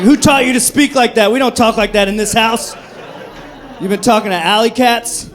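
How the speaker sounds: loud, indignant telling-off, played for laughs; mock parental scolding. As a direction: scold them like an outraged parent. Plays parent scolding foul-mouthed cats.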